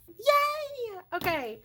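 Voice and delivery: a high, happy cheer; excited yay. Real, not acted. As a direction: squeal with real joy. Celebrates debt paid off.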